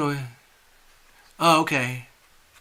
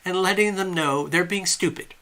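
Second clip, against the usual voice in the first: ordinary talk, nothing marked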